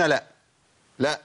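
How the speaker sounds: normal talking voice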